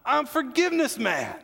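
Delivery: mock-heroic forgiveness man; cocky superhero boast. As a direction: crow it like a comic-book champion. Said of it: "it was like".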